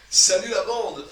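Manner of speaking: high, slow mock villain drawl; trying out an over-the-top baddie; hammy villain try. Shown as a demonstration. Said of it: "c'est plus dur que ce que je pensais".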